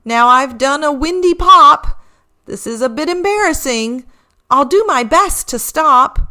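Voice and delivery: sheepish reindeer, a touch embarrassed; mildly embarrassed Rudy; lightly voiced Rudy. A narrator's reading. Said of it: "said Rudy".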